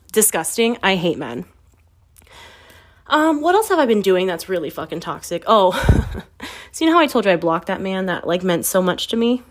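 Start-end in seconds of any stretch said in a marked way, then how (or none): none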